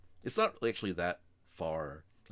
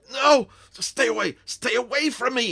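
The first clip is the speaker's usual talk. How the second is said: frantic high-pitched yelling; panicked shouting; 16 dB louder than usual. Acted. He pushes the player and runs, panicked shout. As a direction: shout them away, high and very loud.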